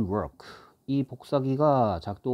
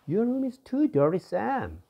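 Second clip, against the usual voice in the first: voiced high and bright as the complaining friend; a high, scolding friend's voice; high character voice; 13 semitones higher, 4 dB louder, much slower than usual. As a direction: pitch it way up for the friend's line. Teacher voices character A again.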